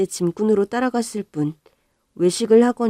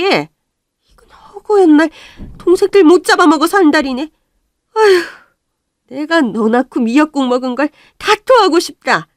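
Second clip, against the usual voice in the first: angry scolding; 8 semitones higher, 9 dB louder than usual. Acted. Mother berates daughter.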